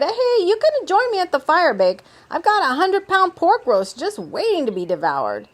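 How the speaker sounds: high, loud, eager host voice; hearty Kay invitation. A narrator's reading. Kay invites Dakar to the pork roast, high hearty voice. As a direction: project up high, easing down at the end.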